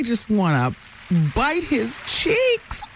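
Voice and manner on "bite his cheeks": high, slow cooing gush. Real, not acted. Host gushing about a friend's baby, slow high coo.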